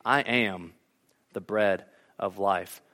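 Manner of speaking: Jesus' famous words, soft and grave; solemn proclamation. Retold or imitated. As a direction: let the words land slowly. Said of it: "the words that we all know".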